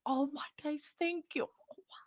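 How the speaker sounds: high, soft, overwhelmed thanks; close to tears. Real, not acted. Streamer overwhelmed by viewers' support, near tears. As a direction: fade to a breath as happy tears threaten. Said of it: "You guys are gonna want me cry".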